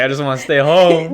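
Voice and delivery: mock complaining teen; whiny stay-home brother. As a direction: whine like a homebody kid brother. Imitating brother wanting home.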